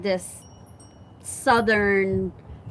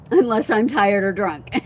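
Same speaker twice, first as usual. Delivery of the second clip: ordinary talk, nothing marked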